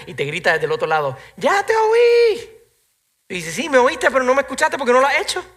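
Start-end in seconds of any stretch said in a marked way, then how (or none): 1.4-2.5 put-on high, loud, drawn-out kid's call from far away; a kid shouting lazily from another room; child yelling back. 3.5-5.4 higher, louder parental reproach; mild scolding of a child. Retold or imitated.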